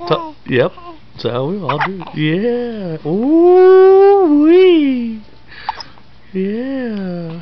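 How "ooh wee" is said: high, loud ooh wee; excited squeal. Real, not acted. Excited cooing at baby.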